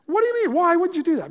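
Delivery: high, loud, indignant demand as the upset classmate; mock furious, high and loud; mock angry outburst. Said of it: "And she got mad".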